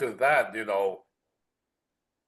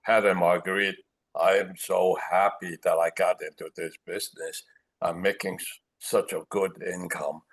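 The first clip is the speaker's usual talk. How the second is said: lightly imitated speaker, lower voice; Hong Tai; 6 semitones lower than usual. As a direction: quote him in a low, subdued register. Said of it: "He would say".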